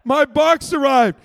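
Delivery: loud, high mock squeal of delight; mock thrilled. Pastor acting out opening the Amazon box, loud thrilled gushing. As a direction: gush over the box like a kid. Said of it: "you still open the door and go".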